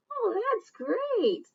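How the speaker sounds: slow high pleased reply. Acted. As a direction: answer sweetly and glad. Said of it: "the one that's responding".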